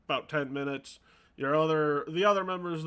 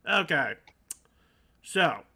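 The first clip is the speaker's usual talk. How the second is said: ordinary talk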